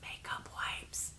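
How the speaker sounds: hushed, breathy reveal for effect; mock secret whisper. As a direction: whisper it like a guilty confession. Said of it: "get ready to gasp".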